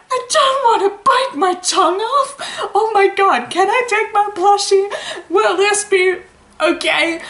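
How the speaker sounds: high, tearful whimpering voice; crying high-pitched. Retold or imitated. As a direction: whine it out, crying and laughing. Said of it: "I was like".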